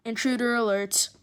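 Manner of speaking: loud mock intruder alert; loud playful warning call; mock alarm call. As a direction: sound the alarm like a siren.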